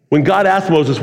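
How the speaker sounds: normal talking voice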